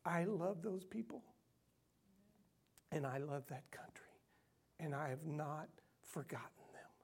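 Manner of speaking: the voice of God, falling to a whisper; hushed voice of God. Retold or imitated. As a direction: let God's words sink to a near whisper, gently. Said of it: "God said to me".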